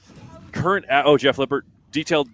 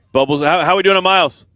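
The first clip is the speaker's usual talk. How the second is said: loud, higher-pitched call to a colleague; 8 semitones higher, 12 dB louder than usual. Real, not acted. Announcer hailing Bubbles for the mile count, calling out loudly. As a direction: raise your voice to get someone's attention.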